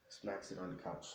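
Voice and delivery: ordinary delivery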